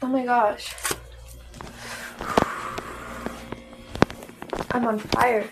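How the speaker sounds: talking with a burning mouth; mouth burning from spicy food. Real, not acted. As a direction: mouth on fire from spice. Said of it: "My mouth is on fire".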